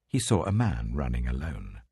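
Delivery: usual speaking voice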